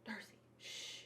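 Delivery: hushed whisper to the dog; whispered shush. Real, not acted. Hushing her dog mid-review.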